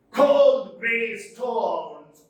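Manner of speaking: Cold grey stones dragged very slow, every word hit loud; slow spondee stress. Shown as a demonstration. Teacher demonstrating a spondee, slow and heavy. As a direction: beat each syllable evenly and heavily.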